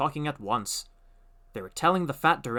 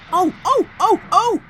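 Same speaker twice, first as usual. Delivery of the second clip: high, loud squealing trucks; screaming trucks; 12 dB louder than usual. A narrator's reading. Trucks jerked forward, screaming in alarm. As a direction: shriek as the couplings jerk. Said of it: "they screamed".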